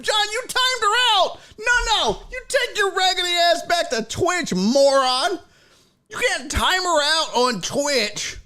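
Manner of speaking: high-pitched comic tirade; worked-up, indignant rant; mock-outraged scolding. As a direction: tell him off in exaggerated exasperation.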